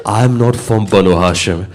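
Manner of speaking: low, hushed grieving telling of the martyrdom; hushed mournful recitation. Acted. Preacher to mourners at majlis, hushed grieving lament.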